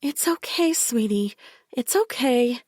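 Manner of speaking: high, syrupy-sweet coaxing; sickly sweet. A narrator's reading. Bella soothing the frightened narrator in a sickly sweet voice. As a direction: coo it like thick sickly syrup. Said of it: "she said in a voice like thick, sickly sweet syrup".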